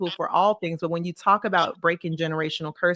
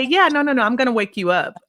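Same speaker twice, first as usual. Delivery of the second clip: tag retold reassurance; 6 semitones higher, 5 dB louder, faster than usual. Mom brushing off child's worry.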